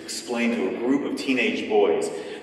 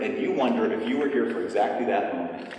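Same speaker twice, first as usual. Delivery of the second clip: ordinary delivery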